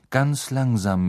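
ordinary delivery

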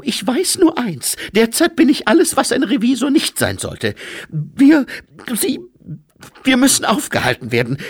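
high, loud, agitated lady; fast and high, faltering over the pronouns; Lady Lejeune. Acted. Lady Lejeune confessing what she has become, high, loud and agitated.

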